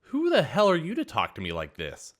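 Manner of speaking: entitled waitress sneering at the boss; indignant outburst. A narrator's reading. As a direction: snap back in disbelief. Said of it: "she asked".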